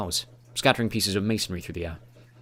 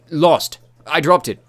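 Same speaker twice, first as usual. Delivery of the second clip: loud; 7 semitones higher, 9 dB louder, slower than usual. A narrator's reading. Shouted reply in battle.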